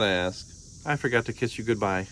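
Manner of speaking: usual speaking voice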